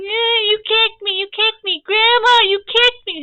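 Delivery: high, loud, drawn-out tattletale wail; exaggerated whiny cry, rising in volume; mock whining. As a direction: imitate a sister whining to Grandma. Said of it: "she's just whining".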